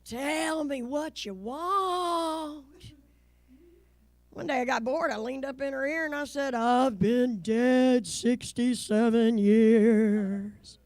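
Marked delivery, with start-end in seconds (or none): 0.0-2.6 drawn-out, theatrical pleading; slow plea. Acted. Mom pleading with the ghost of Mister Barnett, slow plea. 6.5-10.8 loud, very slow ghostly wail. Acted. Ghost answering mom in her ear, loud slow ghost voice.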